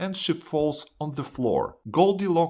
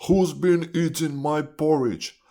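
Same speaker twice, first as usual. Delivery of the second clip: big father bear demanding an answer; storybook papa bear, higher and insistent; 4 semitones higher, 4 dB louder than usual. A narrator's reading. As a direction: play an indignant father bear. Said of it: "Father Bear says".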